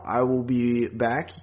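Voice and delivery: usual speaking voice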